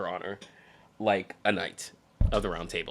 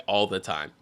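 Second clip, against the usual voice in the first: ordinary talk, nothing marked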